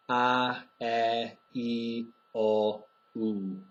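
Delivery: each vowel drawn out long; long Czech vowels. Shown as a demonstration. Teacher contrasting long vowels for learners. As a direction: hold every vowel longer. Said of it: "This means they're long vowels".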